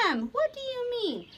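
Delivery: high-pitched, alarmed question; gasped. A narrator's reading. Wife questioning the visitor's grim remark, gasping. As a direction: gasp it in sudden worry. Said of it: "Polly gasped".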